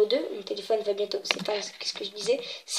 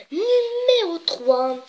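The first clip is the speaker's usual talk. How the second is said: high, loud, drawn-out announcer call; countdown announcement; 8 semitones higher, 5 dB louder, much slower than usual. Acted. Host announces the next place in the countdown, showy announcement. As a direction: stretch it out like a big reveal.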